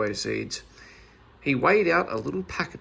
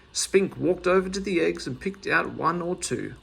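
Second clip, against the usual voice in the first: ordinary delivery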